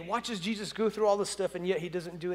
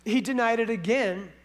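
ordinary delivery

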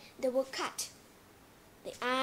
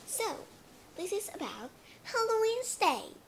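ordinary talk

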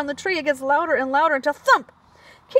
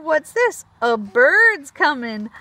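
usual speaking voice

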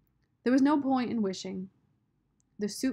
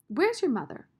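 high, louder, sharp demand; sudden demand; 8 semitones higher, 5 dB louder than usual. A narrator's reading. Sistine demands to know about his mother, sudden loud question. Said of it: "Sistine demanded suddenly".

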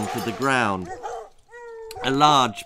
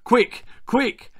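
higher urgent cry; boy faking alarm on the phone, higher; shouted; 10 semitones higher, 4 dB louder than usual. A narrator's reading. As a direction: fake panic. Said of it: "shouted Arthur".

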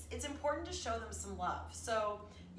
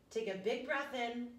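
calm breathing cue; slower than usual. Shown as a demonstration. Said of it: "breathing cue, slow".

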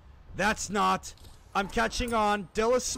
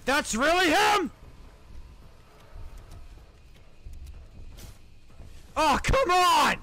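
yelling; 7 semitones higher than usual. Real, not acted. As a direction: shout in protest at the game. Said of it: "Sorry, I'm yelling".

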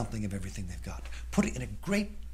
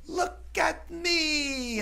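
very high, slow sing-song show-off; girl presenting her makeup to her parents; 3 dB louder, much slower than usual. Acted. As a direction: drag it out proudly like a little diva. Julie shows off again.

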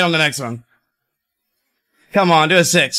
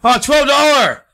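high victory yell; excited shout; 12 semitones higher, slower than usual. Real, not acted. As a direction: cheer the big multiplier. Big Keno win.

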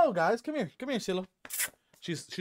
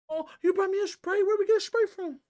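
much higher, louder delighted coo to the dog; high dog-talk voice; 8 dB louder than usual. Real, not acted. Talking to the dog, amused.

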